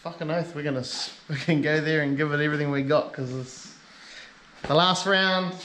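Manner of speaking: ordinary delivery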